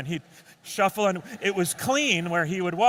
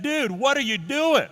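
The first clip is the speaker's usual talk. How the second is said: laughing disbelief; 6 semitones higher, 3 dB louder than usual. Retold or imitated. Finding cat stuck up tree.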